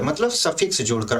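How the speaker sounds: ordinary delivery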